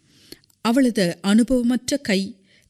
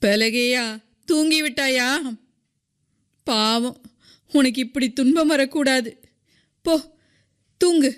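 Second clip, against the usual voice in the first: tipsy, pitying woman in a raised voice; drunken voice; 6 semitones higher than usual. A narrator's reading. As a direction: call to her drunkenly, pitched high. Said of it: "போதை மிகுந்த குரலில் கூப்பிடுவதை".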